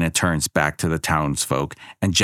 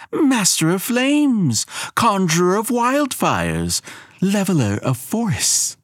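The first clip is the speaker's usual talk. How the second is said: grandiose goddess; 11 semitones higher than usual. A narrator's reading. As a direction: savour her own titles. Elena's voice, grandiose titles.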